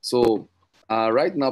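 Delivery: ordinary talk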